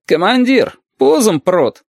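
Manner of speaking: high-pitched call to a superior. A narrator's reading. Sentry reports intruders to his commander, calling out.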